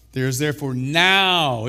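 NOW swelling loud and long; slow lead-up to a big NOW; stress on NOW. Shown as a demonstration. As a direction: land hard on "now" and hold it. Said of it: "Let's emphasize the word now".

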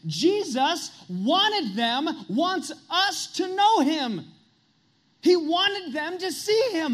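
full-voiced preacher's peak; preaching at full force. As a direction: cry out as if Jesus stood among them. Preaching climax, voicing Jesus.

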